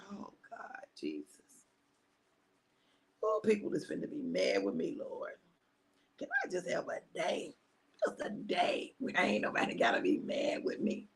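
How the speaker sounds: very soft, defeated murmur; resigned plea. Retold or imitated. Giving in to God while dreading people's anger, hushed resigned plea. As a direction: sink into a hushed, reluctant prayer.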